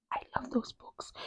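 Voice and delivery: secret confiding whisper. Real, not acted. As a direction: confess it under your breath. Guilty-pleasure confession.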